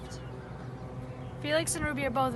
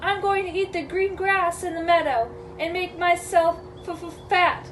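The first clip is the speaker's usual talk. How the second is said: higher, timid goat voice with a stammer; scared little reply, pitched up; nervous middle goat; 4 semitones higher, 7 dB louder than usual. Acted. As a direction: stutter on the last word in fright. Frightened middle goat answers troll.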